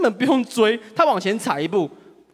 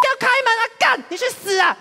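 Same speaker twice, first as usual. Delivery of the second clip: much higher furious shout; cursing yell; 13 semitones higher than usual. Retold or imitated. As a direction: scream curses through the window. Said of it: "狂大骂脏话".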